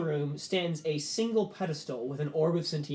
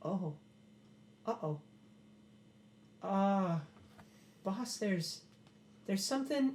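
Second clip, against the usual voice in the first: a hint of nervous worry, rising in pitch toward the end; halting, creeping unease as he spots something; nervous servant; 5 semitones higher, 4 dB quieter, slower than usual. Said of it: "Percival peers around and goes".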